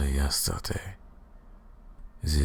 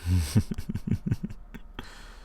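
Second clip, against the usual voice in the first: soft evil chuckle; 3 semitones higher, 3 dB quieter than usual. Acted. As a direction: chuckle under your breath with contempt. Vampire mocking her prisoner.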